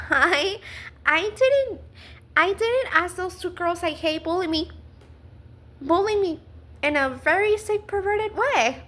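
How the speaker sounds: incredulous, worked-up denial; indignant protest. Real, not acted. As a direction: protest the blame with rising outrage. Indignant answer to victim blaming.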